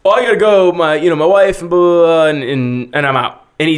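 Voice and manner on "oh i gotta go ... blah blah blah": louder, high-pitched retold excuse; flustered excuse. Spooked guy excusing himself to leave, flustered. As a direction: imitate someone anxious to get away.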